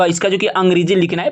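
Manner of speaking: normal talking voice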